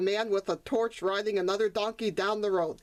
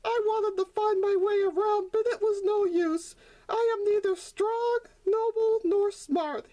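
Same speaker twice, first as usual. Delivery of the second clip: high, teary little voice; sad little donkey; 11 semitones higher, 4 dB louder than usual. Acted. Sonny tells his mother he failed, high and teary. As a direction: let it sound close to tears. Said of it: "His tears began to fall".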